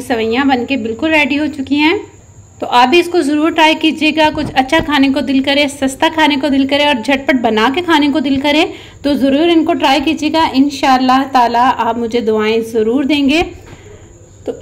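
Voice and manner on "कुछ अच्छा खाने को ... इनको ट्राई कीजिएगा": normal talking voice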